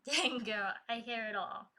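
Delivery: ordinary delivery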